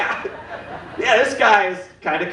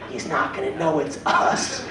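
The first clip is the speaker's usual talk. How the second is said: softer, sly conspiratorial voice; mild hushed scheming; sneaky collector; 3 semitones lower, 5 dB quieter than usual. Retold or imitated. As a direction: say it like a smug sneaky caller. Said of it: "They're like".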